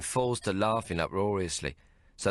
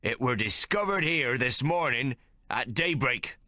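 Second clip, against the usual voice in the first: slow accusing mate's voice; accusingly; 6 semitones higher than usual. A narrator's reading. Mate accusing the boys, slow and pointed. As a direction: lay the blame slowly. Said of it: "he says accusingly".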